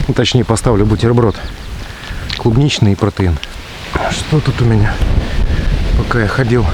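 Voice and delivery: normal talking voice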